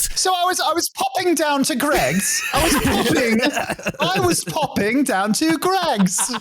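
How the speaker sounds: high, put-on British comedian voice; hammed-up British bloke; mock British voice. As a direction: play a chirpy UK comic popping to Greggs.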